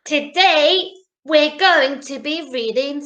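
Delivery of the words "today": usual speaking voice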